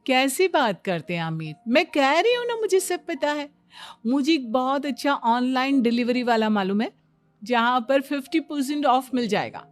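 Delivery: eager bragging with rising pitch; animated, self-assured wife; know-it-all daughter-in-law. Acted. Daughter-in-law boasts of delivery knowledge.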